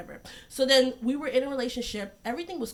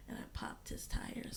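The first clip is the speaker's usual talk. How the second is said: whispered confession; 14 dB quieter, slower than usual. Real, not acted. Confessing quietly.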